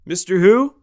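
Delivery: loud, high puzzled question; loud confused caller on the phone; confused Mister who. Retold or imitated. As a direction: shout it back baffled. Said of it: "this guy's like".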